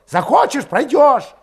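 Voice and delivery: high, loud, testy outburst; sudden angry snarl. A narrator's reading. Valet turning on his doubtful comrade, snarling. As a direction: snap back in a high, irritated voice. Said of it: "окрысился вдруг Валет".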